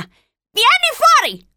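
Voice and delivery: high, loud, drawn-out call; shouted order. A narrator's reading. Old woman ordering Charlie out of the shack, shouting. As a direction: holler it up high, stretching the words.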